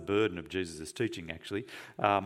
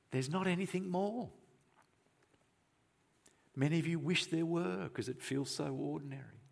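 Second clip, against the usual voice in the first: high, soft, sympathetic admission; soft, high voice of shared longing; gentle confiding; 5 semitones higher, 6 dB quieter than usual. Real, not acted. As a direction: drop the volume and lift the pitch, confiding. Gently admitting a shared disappointment.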